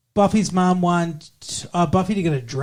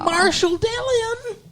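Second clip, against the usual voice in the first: high, slow cartoon cowboy drawl; old prospector; slower than usual. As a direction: stretch it out like an old-timer. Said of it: "That's from Gunsmoke".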